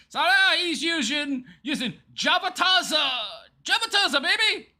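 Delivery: loud, high mock-excited shout; hammed-up announcer cheer; mock hype. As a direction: hype the brand name up sarcastically. Mocking hype for coffee brand.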